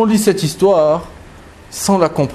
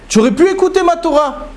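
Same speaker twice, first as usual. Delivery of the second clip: reproche plus aigu, insistant; un maître blessé qui interpelle son élève; 11 semitones higher than usual. Acted. Rabbi Eliezer mourant reproche à Akiva. (Rabbi Eliezer reproche à Rabbi Akiva, voix montée)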